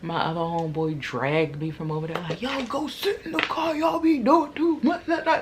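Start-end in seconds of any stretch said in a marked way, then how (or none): 2.4-5.4 exasperated friend. Retold or imitated. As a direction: scold them half laughing. Homeboy drags her away.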